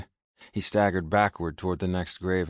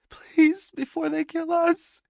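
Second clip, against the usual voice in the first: very high, loud, desperate begging; frightened plea; 6 dB louder, slower than usual. A narrator's reading. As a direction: plead urgently in fear. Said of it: "she pleaded".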